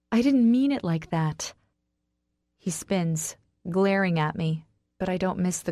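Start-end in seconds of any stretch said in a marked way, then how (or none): 0.1-1.5 higher, strained plea; a touch choked, begging to be believed. A narrator's reading. Wife apologising to husband, choked. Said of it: "I choke out".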